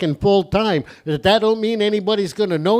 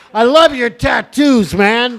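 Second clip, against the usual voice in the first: loud callout; 3 semitones higher, 5 dB louder, slower than usual. Real, not acted. Host calling out to audience.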